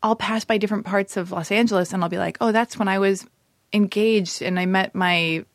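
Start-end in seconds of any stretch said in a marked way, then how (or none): none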